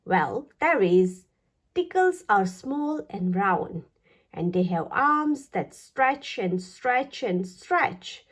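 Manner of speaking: ordinary delivery, nothing marked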